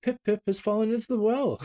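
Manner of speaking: high, loud, breathless gasp of news; gasping boy. Acted. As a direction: blurt it out with the last breath. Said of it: "he gasped".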